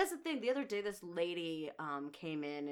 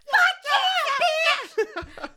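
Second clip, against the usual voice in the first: much higher, much louder shout with a laugh; party blueberry voice; 16 dB louder, much slower than usual. Pretend. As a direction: yell it like a wild party blueberry. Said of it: "they're like".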